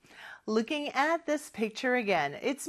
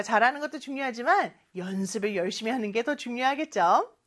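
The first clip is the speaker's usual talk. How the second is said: normal talking voice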